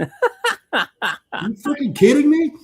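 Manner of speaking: high, very slow, stretched-out disbelief; stunned disbelief. Retold or imitated. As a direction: drag out every word in shock. Told the deck might collapse.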